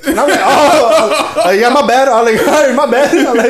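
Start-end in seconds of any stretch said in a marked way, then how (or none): 0.4-2.1 high, laughing, backing-down voice; sheepish mock apology. Retold sheepish apology to cashier. 2.5-3.2 high, laughing, backing-down voice; sheepish mock apology. Retold sheepish apology to cashier.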